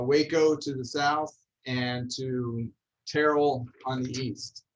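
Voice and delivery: ordinary delivery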